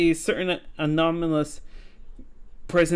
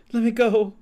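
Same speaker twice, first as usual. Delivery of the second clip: higher, louder pleading cry; louder desperate plea; 7 semitones higher, 5 dB louder than usual. Acted. Ghost begging again, higher and louder. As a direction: beg harder to be let go.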